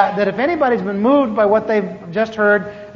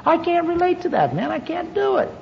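ordinary talk

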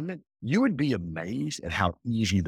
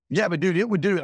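put-on higher and louder, insistent young hire; pushy young guy; 8 semitones higher, 5 dB louder, faster than usual. Social media hire insists on the car photos, higher and louder. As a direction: push back like an eager kid who won't drop it.

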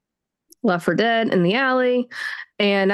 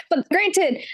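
normal talking voice

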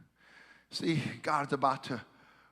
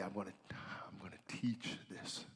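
hushed whisper to himself; whispered self-talk; 8 semitones lower, 15 dB quieter than usual. Retold or imitated. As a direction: whisper it as a private pep talk.

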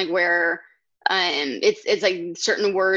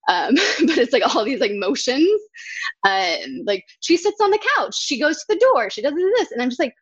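usual speaking voice

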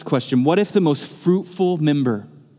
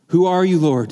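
startled cry; 3 semitones higher, 3 dB louder than usual. Acted. Saul cries out at voice.

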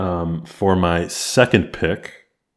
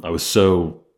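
usual speaking voice

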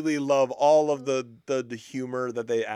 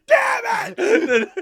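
much higher, loud shouted curse; mock furious shout; 9 dB louder than usual. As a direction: yell it in comic fury. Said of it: "And I said".

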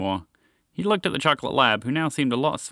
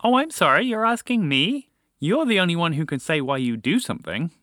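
high, incredulous exasperation; exasperated; 7 semitones higher than usual. A narrator's reading. Lab asked to explain moose's choice, exasperated. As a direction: push the pitch right up in disbelief.

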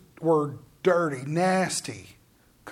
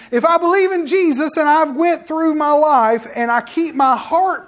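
louder, higher preaching push; rising preaching; 6 semitones higher, 5 dB louder than usual. Acted. Preacher to congregation, rising into louder preaching. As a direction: build the congregation toward conviction. Preacher building up over belief.

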